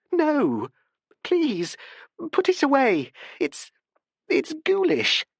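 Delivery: high, urgent plea; pleading in distress. A narrator's reading. Barbara begs the professor to hide the blade, high and urgent. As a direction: beg him to put it away.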